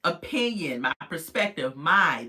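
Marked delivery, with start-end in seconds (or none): 1.8-2.2 my said louder and held; stress on my. Shown as a demonstration. Vlogger stressing my to viewers, louder my. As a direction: lean hard on the word my. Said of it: "That is the key word".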